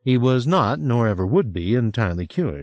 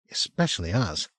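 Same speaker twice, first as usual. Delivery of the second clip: softer, dropping the voice confidentially; dropped voice; 5 dB quieter than usual. A narrator's reading. Franny confides in Will, voice dropped softer. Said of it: "She dropped her voice".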